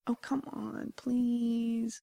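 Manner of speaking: mock begging, small and coy; playful pleading. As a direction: coax with a drawn-out please. Playfully begging co-host after his maybe.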